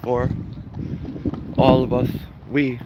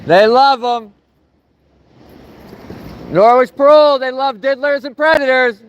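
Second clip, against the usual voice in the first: protest shouting; 7 semitones higher than usual. Real, not acted. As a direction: bellow it like a protest chant. Protest chant at parole office.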